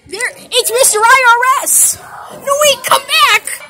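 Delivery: excited shout. Real, not acted. Chasing the taxman in game.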